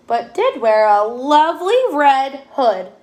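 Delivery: ordinary delivery, nothing marked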